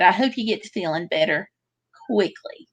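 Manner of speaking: "quickly" set apart after a short pause; pause, then deliver "quickly" as its own word; stress on quickly. Real, not acted. Wishing a sick viewer better, stress on "quickly". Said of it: "put the emphasis on quickly".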